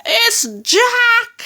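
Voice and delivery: very high, terrified bellow; high panicked holler. A narrator's reading. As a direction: bellow the alarm, pitch shooting up. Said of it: "bellowed the flesh lump eater".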